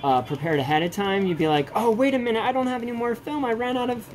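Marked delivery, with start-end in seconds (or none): 1.7-4.1 high, fast flustered "oh, wait"; mock dismay. Photographer discovering he is out of film, mock dismay. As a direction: act out realizing the film is gone. Retelling an exasperated moment.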